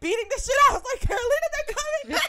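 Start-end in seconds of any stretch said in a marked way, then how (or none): 1.0-2.3 high, loud squeal through laughter; retold frantic yell. Fan warning her distracted friend the idols are coming, frantic yell. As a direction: re-enact yelling to get a friend's attention.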